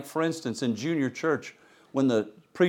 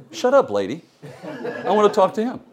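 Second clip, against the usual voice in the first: mock snap; 6 semitones higher, faster than usual. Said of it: "I wanted to say".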